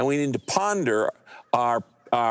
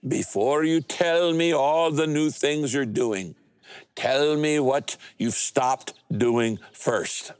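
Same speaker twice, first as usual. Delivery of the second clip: put-on the mentor Peter, Austrian accent, unhurried; thick Austrian accent; much slower than usual. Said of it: "in his thick Austrian accent".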